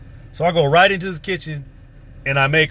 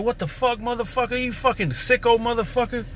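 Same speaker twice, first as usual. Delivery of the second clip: high, disgusted cursing at him; outraged yell; 8 semitones higher, 3 dB quieter than usual. Retold or imitated. Boy jumping out of bed at the man, high outraged cursing. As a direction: lash out in shock and disgust. Jumping up after being groped.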